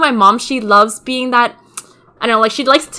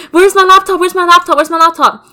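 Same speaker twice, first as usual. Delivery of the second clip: high, loud frantic calls for the laptop; frantic calling; 7 semitones higher, 5 dB louder than usual. Retold or imitated. Searching the store for her stolen laptop, calling out frantically. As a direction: call out in a panic, searching.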